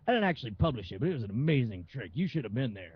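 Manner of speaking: lightly voiced washed-up bragger, a little low; a hint of the guy boasting about his glory days; office party has-been. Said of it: "the guy at the office party would be like".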